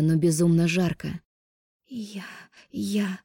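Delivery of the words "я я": quiet, breathless stammer. Acted. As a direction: gasp the words out softly. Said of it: "Тяжело дыша".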